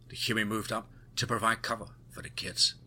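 ordinary delivery

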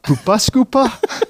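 high Geordie lilt on "scooper"; quick Geordie impression; Geordie accent. Shown as a demonstration. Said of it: "Instant Geordie accent".

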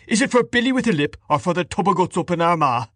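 high-pitched, mocking host; indignant, derisive question; scornful Mr Dedalus.

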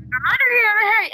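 high-pitched mock yell for help; retold harder shouting. Host as trapped man shouting even harder, high mock yell. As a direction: cry out urgently, pitch way up. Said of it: "he shouted even harder".

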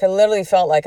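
normal talking voice

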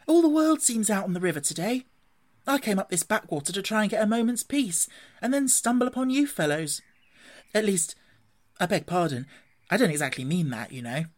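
lightly voiced otter, high then settling into apology; grumbling otter. Otter complains to Rat and Mole about the crowded river, mildly put out.

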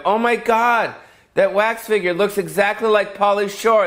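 high, excited tourist gasp; gushing tourist. Retold or imitated. Starstruck tourist gushing over a wax figure, high and excited. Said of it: "the tourists will come by and be like".

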